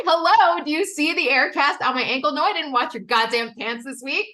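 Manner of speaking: loud, high exasperated retort, half laughing; exasperated outburst. Retold or imitated. To her husband who asked about his pants, loud exasperated outburst. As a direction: blow up at him, incredulous.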